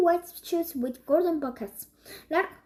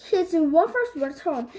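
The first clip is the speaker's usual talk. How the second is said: plain reading voice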